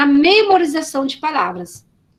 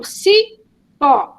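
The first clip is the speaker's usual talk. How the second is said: CIPÓ in two slow beats, ci... pó; split into syllables; 3 dB louder than usual. Shown as a demonstration. Teacher showing how the child divides the word, split into syllables. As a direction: pull "cipó" apart, pausing between its syllables. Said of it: "a criança vai dividir".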